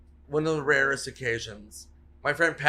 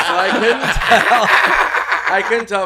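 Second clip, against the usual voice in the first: laughing; 6 semitones higher, 12 dB louder than usual. Real, not acted. Laughing after the story.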